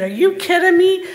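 loud, incredulous comeback; emphatic retort. Retold or imitated. Patient tells the supervisor to worship, emphatic retort. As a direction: push back with forceful conviction.

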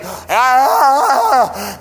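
mock cry of pain. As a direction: yelp as the drill hits. Retold dental drilling pain.

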